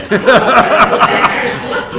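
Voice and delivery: high, breathy peal of real laughter. Real, not acted. Still amused by Terry, laughing high and loud. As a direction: let the laugh run free and high. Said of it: "reacts to Terry's remark".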